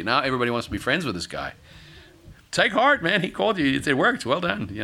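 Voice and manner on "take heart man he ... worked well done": lightly acted eager well-wisher, pitched up; high, put-on congratulating; mock encouragement. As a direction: cheer someone on like a fickle new friend. Voicing the suddenly friendly fickle crowd.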